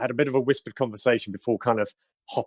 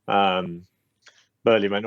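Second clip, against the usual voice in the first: normal talking voice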